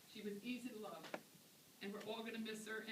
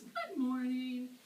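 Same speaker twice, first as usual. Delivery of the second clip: slow, drawn-out, high sweet greeting, much louder; imitating Honey; 7 semitones higher, 10 dB louder, much slower than usual. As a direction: drag out a sing-song old lady's morning hello. Honey greets her at dawn.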